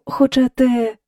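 usual speaking voice

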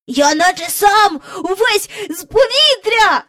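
put-on high, excited boyish shout; boy calling out. A narrator's reading. Michael flies over the park bench, shouting excitedly. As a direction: yell it high in a child's delight. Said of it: "гукав Майкл".

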